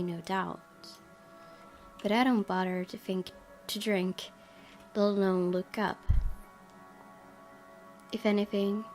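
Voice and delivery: ordinary talk, nothing marked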